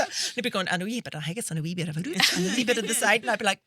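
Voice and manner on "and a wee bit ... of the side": mumbled broad Scottish chef; hint of a thick Paisley accent. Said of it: "thick Scottish accent".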